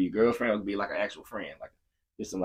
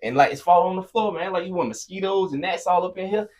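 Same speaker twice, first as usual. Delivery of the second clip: loud, much higher, heated rant; angry rant; 9 semitones higher, 7 dB louder than usual. Retold or imitated. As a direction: tear into him about the overflowing trash. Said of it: "not having patience".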